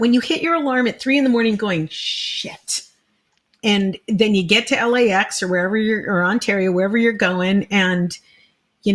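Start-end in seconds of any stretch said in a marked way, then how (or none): 1.9-2.8 hushed, voiceless curse under the breath; muttered dread. Retold or imitated. Comedian hitting the alarm at three, muttered dread. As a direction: swear softly, almost all air.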